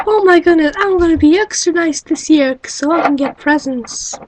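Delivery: put-on high, loud burst of kid excitement; excited child. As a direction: gasp with joy at hearing about Santa. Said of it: "you're like".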